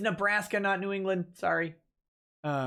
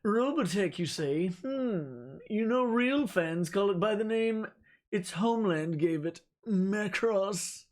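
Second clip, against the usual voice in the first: slow, haughty, higher snob voice; snobby rich guy; 3 semitones higher, slower than usual. Acted. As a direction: look down your nose at them, slowly. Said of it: "Read this in a snobby rich guy voice".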